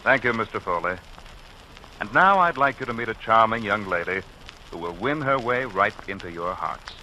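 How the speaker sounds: ordinary delivery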